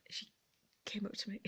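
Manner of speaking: hushed whisper, as if she crept up. Retold or imitated. Telling viewers how the lady crept up, whispered. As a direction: drop to a secret whisper.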